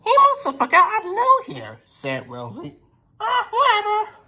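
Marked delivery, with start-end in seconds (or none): 0.0-1.8 very high, light little-engine voice; high Rosie voice. Acted. Rosie greeting Donald, mistaking him for Douglas, very high and light. 3.2-4.2 very high, light little-engine voice; high Rosie voice. Acted. Rosie greeting Donald, mistaking him for Douglas, very high and light.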